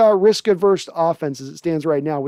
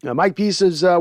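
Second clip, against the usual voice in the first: ordinary delivery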